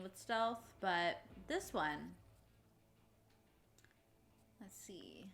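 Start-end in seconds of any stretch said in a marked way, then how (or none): 4.6-5.3 hushed stealthy whisper. Real, not acted. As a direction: breathe it under your breath. Sneaking past enemy in stealth game.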